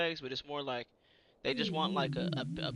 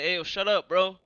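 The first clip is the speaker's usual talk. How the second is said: loud repeat, pitched up; frustrated retort; 4 semitones higher, 13 dB louder than usual. Retold or imitated. Soldier repeating his retort to the stranger, loud and frustrated. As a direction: re-enact doubling down, loudly fed up. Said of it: "I was getting so frustrated".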